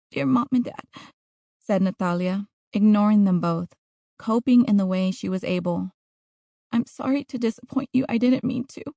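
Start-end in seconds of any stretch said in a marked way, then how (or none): 0.1-0.9 lightly voiced girl, raised pitch; Natalia reciting her letter. Trapped girl recites a letter to her parents, lightly voiced. 6.7-8.8 put-on higher-pitched young girl's voice; Natalia reciting her apology. Trapped girl recites an apology to her parents, higher girl's voice.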